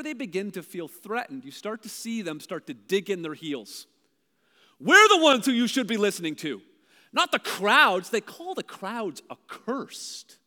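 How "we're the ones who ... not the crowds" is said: loud, superior and dismissive; haughty claim. Retold or imitated. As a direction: puff up like an insecure authority. Mocking the threatened Pharisees.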